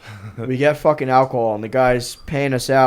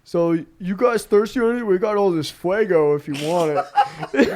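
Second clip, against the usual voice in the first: lightly voiced promoter, higher; a hint of a higher, excited promoter; quoting promoter; 3 semitones higher than usual. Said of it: "She's like".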